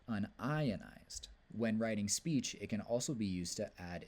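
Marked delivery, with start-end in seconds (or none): none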